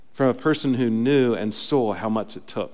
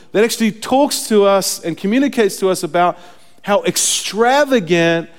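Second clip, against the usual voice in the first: loud, higher sermon delivery; impassioned preaching; 8 semitones higher, 7 dB louder than usual. Real, not acted. Preacher explains what God's love required, impassioned. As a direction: press each point home with fervor.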